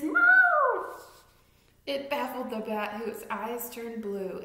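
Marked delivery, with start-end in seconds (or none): 0.0-0.9 very high, loud yowl; cat meow. Acted. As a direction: hiss it like an angry cat. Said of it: "who hissed".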